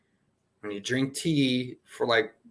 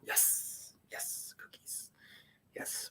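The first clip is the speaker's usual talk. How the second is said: slow breathy whisper of yes cookies; 20 dB quieter, much slower than usual. Real, not acted. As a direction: whisper it like a secret treat. Whispered aside about cookies.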